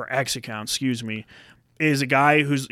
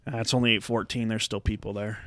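much softer, grumbled to himself; mock dismayed muttering; 6 semitones lower, 10 dB quieter than usual. Man grumbling about crowds at the store, soft. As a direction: mumble it under your breath. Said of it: "I'm like".

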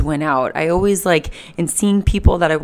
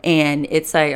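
normal talking voice

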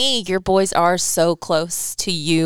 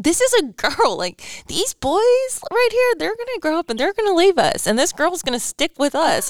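earnest mock pleading; 10 semitones higher than usual. Wife telling husband a girl will stay close, high earnest plea. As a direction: plead your case to your husband with mock drama.

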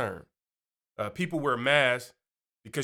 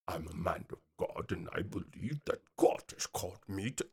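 much deeper, hushed mock-solemn preacher; pastor voice; 9 semitones lower, 10 dB quieter than usual. Pastor putting on his mask at church, deep pastor voice. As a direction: drop way down low and soft like a self-important bishop. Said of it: "get into their pastor voice".

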